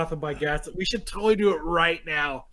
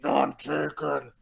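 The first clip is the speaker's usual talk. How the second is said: slow, lower gruff voice; 4 semitones lower, 4 dB quieter, much slower than usual. Acted. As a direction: growl it out low and slow. Gruff reply reading a character.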